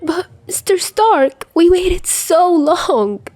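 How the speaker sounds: put-on teen begging Mister Stark, slow and high; stricken whine. A narrator's reading. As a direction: plead like the day is ruined. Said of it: "Peter looks stricken".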